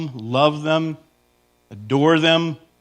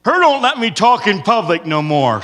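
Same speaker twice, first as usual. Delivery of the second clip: mock henpecked husband; 4 semitones higher, 4 dB louder than usual.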